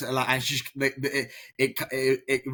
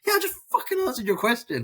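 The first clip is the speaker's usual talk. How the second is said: higher, half-mock teen outrage; fed-up student's squeaky protest; exasperated outburst; 14 semitones higher than usual. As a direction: snap back in mock disbelief. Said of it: "And I'm like".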